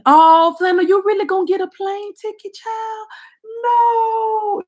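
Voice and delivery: high, shocked squeal at a chat comment; disbelieving high-pitched outburst; surprised. Real, not acted. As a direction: caught off guard, voice jumps way up.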